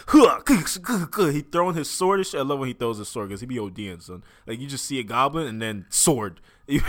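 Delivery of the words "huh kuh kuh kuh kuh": high, punchy slashing noises; imitated fight noises. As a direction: mimic weapons hitting in a fight.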